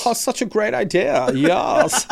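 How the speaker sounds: put-on high, gushing camp German accent; hammed-up German accent, high-pitched; comic Nazi voice. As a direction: agree as a fawning camp German officer. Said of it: "if you do a Nazi voice".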